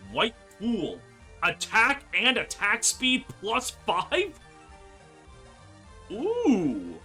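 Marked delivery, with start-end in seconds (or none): none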